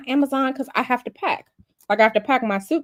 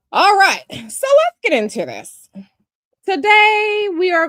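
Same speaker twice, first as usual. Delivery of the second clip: 1 loud hype intro; 9 semitones higher, 7 dB louder than usual. Real, not acted. Launching the stream topic.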